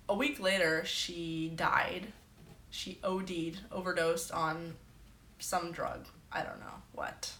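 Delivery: ordinary talk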